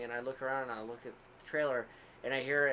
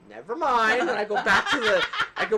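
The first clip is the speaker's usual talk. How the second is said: laughing it off, louder and higher; 8 semitones higher, 12 dB louder than usual. Retold or imitated. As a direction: toss the line away through laughter. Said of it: "and I'm like".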